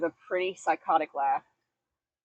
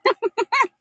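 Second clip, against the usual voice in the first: high evil giggle; 14 semitones higher, 7 dB louder than usual. Pretend. Laugh after villain line.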